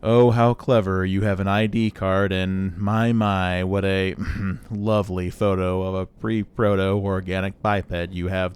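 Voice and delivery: slow, monotone, sarcastic robot; Marvin the paranoid android. Acted. Droid grudgingly serves player at weapons counter, condescending monotone. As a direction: condescend in a level, drawn-out drone. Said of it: "this is very clearly Marvin the paranoid android".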